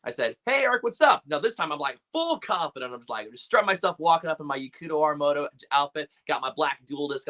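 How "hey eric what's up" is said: excited greeting. Retold or imitated. Greeting voice actor.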